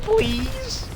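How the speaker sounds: high, stretched pleading please; squeaky begging please. Real, not acted. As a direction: beg the game in a tiny high squeak. Begging the game for a kill.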